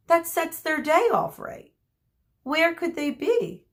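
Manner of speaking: Chiron puzzled. Acted.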